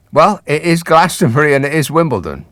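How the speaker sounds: ordinary delivery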